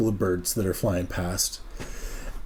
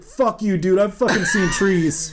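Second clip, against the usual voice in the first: higher, louder, laughing exasperated outburst; exasperated yell; 7 semitones higher, 3 dB louder than usual. Retold or imitated. Friend snaps at narrator over the trees, exasperated laughing yell. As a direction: snap back at him through a laugh. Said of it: "He's like".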